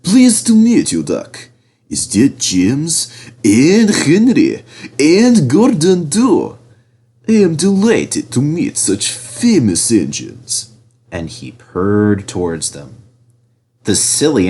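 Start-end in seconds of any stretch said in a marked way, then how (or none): 0.0-10.7 oily, much higher, slow and louder flattery; oily Diesel. A narrator's reading. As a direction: ooze false charm. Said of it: "purred Diesel in an oily voice".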